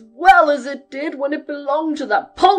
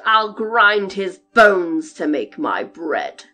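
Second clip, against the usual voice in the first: huge, low, booming giant's roar; thundering voice; 4 semitones lower, 11 dB louder, slower than usual. Acted. Giant storming in and sniffing for an Englishman, booming low roar. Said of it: "a voice like thunder cried out".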